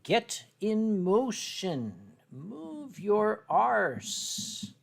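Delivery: very slow, each word stretched; drawn out while writing. Real, not acted. Artist writes orders to a lazy fox, slowly drawn out. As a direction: draw every word out at writing pace.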